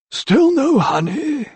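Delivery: high, slow, moaning complaint. A narrator's reading. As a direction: whine it out like a fussy old doctor. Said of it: "moaned Doctor Carruthers".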